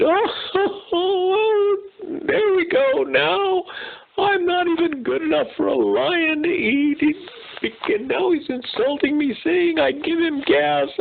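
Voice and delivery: high, slow, whiny sobbing zebra voice; overly dramatic zebra. Acted. Zebra stuck in the mud wailing to himself, hammed-up sobbing. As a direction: wail like a hammed-up crybaby zebra.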